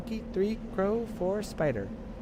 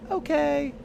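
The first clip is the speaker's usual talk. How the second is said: ordinary delivery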